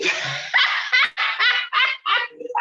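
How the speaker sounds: high, loud cackling laugh; loud burst of laughter. Real, not acted. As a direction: burst out laughing at your own joke. Laughs at her own tease.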